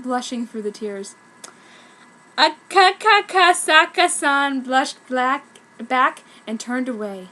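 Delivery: plain reading voice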